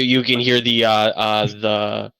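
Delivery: normal talking voice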